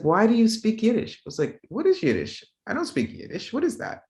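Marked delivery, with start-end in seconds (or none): 0.0-1.1 high, louder imitation of a puzzled classmate; baffled question. Classmate to the teller about Yiddish, mock bafflement. 1.7-4.0 bewildered reply. Retold or imitated. Student to classmate, hearing of Yiddish first, mock bewilderment.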